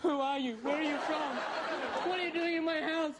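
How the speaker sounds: usual speaking voice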